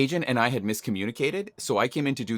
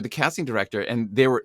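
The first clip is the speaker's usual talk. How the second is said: ordinary talk, nothing marked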